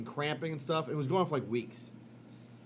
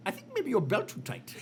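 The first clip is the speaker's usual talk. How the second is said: put-on higher, deadpan Indian accent diagnosis; the doctor's Indian accent, offered as a calm verdict; 9 semitones higher than usual. Doctor tells the panicking patient, in an Indian accent. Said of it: "Your impression of Indian guys".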